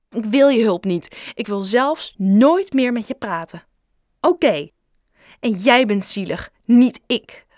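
loud, hurt, final outburst; furious. Acted. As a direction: slam the door on the friendship. Bob furious, ending friendship.

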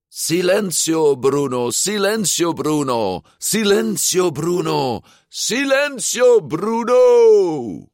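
high chant building to a scream; shrieked. A narrator's reading. Luca on the collapsing Vespa, screaming his mantra. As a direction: repeat it desperately, climbing to a yell. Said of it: "gilde Luca".

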